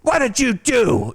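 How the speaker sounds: put-on high, slow drunken outrage; drunken yell. Drunk at a bikini bar, yelling at a stripper in mock outrage. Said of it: "and I'm screaming".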